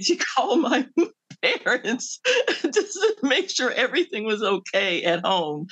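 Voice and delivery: telling it through real laughter; laughing. Real, not acted. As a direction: barely hold back the laugh while telling it. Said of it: "I'm laughing because".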